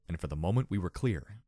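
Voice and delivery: usual speaking voice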